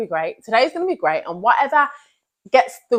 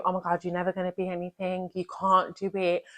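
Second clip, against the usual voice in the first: soft, fast murmur of self-doubt; hushed and hurried, like a nagging inner voice; inner negative voice; 8 dB quieter than usual. Retold or imitated. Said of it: "those thoughts are telling you".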